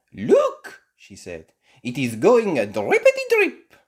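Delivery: high, loud sing-song teacher; French teacher. Acted. Madame Gazelle showing Mister Bull the leak, high. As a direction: point out the drip playfully.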